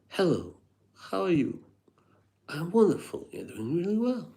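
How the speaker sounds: high, airy posh English voice; posh impersonation. Retold or imitated. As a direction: put on a posh Brit act. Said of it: "video impersonation of Terence Hughes".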